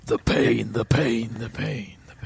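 fading echo of a name. Retold or imitated. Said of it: "and it, like, echoes out".

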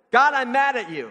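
a raw, mock-mad complaint to God; mock angry prayer. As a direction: blurt it out like an angry child. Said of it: "Have you ever prayed that one".

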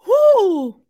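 high, loud "whoo" of relief; loud whew. Real, not acted. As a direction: let out a long whoo after laughing. Exhales after laughing.

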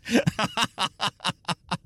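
a high, breathy burst of giggling at his own joke; high giggle. Real, not acted. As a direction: let the giggle escape high and soft.

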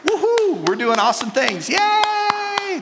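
high squealing "woohoo" and "Yay", stretched; mock cheerleading. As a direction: ham up the forced celebration. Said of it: "people that speak into my life say".

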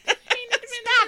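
burst of laughter. Real, not acted. Laughing at squeaky take.

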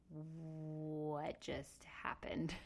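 slow, near-whispered disbelief; stunned hush. Retold or imitated. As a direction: draw it out softly, as if dazed. Dazed reaction right after the birth.

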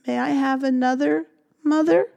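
put-on sweet baby mouse. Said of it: "Baby Mouse smiled".

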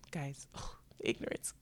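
a hushed, embarrassed whisper to the audience; cringing whisper. Real, not acted. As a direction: whisper it like a guilty confession. Whispered aside to viewers.

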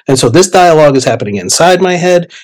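plain reading voice

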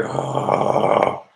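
long voiceless breath of dread; dreading exhale. Retold or imitated. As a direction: let all the air out in mock horror. Told cop will call mum.

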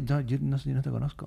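the twin, answering softly; hushed denial. Pretend. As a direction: murmur it, pretending not to know.

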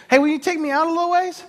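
Jesus breezily asking a favour; cheery request. Retold or imitated. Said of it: "So Jesus jumps on board".

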